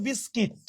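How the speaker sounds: "বিস্কিট" ending in -kit, not -kut; correct pronunciation. Shown as a demonstration. Teacher correcting learners' pronunciation, "বিস্কিট" said with -kit. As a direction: say "বিস্কিট" with an i in the second syllable, -kit. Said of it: "উচ্চারণটা মূলত হবে".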